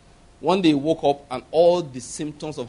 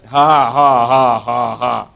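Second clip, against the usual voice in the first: dry forced laugh; 8 semitones lower, 5 dB louder, much slower than usual. Retold or imitated. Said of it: "So he began to laugh".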